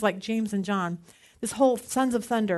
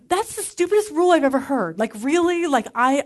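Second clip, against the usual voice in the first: loud, higher, exasperated outburst at staff; indignant rant; 4 semitones higher, 6 dB louder than usual. Pretend. Pretend complaint to rec center staff about shoes, indignant and loud. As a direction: let the outrage burst out louder and higher.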